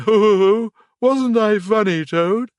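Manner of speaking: loud hearty ho ho ho; laughing Mole. A narrator's reading. Mole boasting to Toad, loud laughing. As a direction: crow with delight at the joke.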